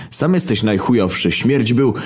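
high, blurted comeback; flustered retort. Acted. Demon defending his dead brother, flustered. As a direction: blurt it defensively, tripping into silence. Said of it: "próbowałem bronić naszego martwego brata, ale nie potrafiłem znaleźć odpowiednich słów".

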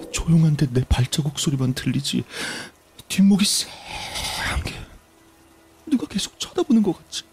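mounting fear. Acted. Telling friends what he imagined in the dark, increasingly spooked. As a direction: let the creepy imaginings wind the pitch up and up.

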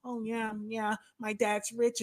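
soft, smug mock-snooty mumble; hushed, self-satisfied snob impression. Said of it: "he always has this, like, snooty".

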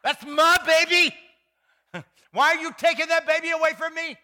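high, loud, half-laughing protest of a new mother; mock possessive mother. Pastor imitates a new mother protesting as her baby is taken. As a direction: ham up a mother clinging to her newborn. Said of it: "you could almost hear her saying".

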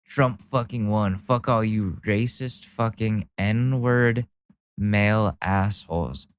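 normal talking voice

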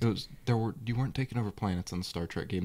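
ordinary talk, nothing marked

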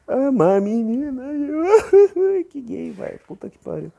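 high, teary laughter, very slow; embarrassed by his own feelings; crying laugh. Real, not acted. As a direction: laugh through tears. Emotional, crying about his crush.

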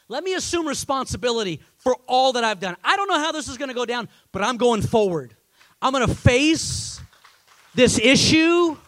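loud, high-pitched sermon climax; full-force preaching. Acted. Preacher voices Jacob running to face Esau, full-force preaching. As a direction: preach it with rising force, stretching key words.

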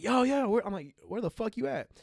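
calling out. Retold or imitated. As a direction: call out for a lost friend. Calling out for lost friend in store.

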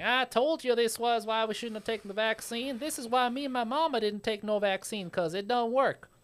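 high-pitched mock southern accent. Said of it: "use my southern accent again".

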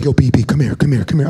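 low, loud buddy calling out; hammed-up pal beckoning from the back; imitating friend Chris. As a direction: call your friend over, low and loud. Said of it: "he's like".

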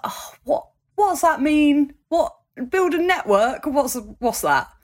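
high, puzzled mock confusion; baffled. Student baffled by advice to build a network, puzzled. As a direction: act out being totally lost.

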